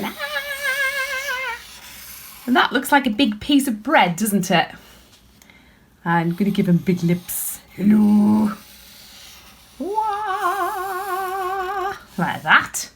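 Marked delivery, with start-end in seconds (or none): 0.0-1.6 a very high, short mock cry of alarm; mock alien shriek. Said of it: "This one is gonna be going". 7.8-8.5 a louder, rounded hello through big lips; a lightly voiced blobby alien greeting. Voicing big-lipped alien. 9.8-11.9 a high, short cartoon wah; a lightly voiced alien cry of surprise; mock alien wail. Voicing big-lipped alien.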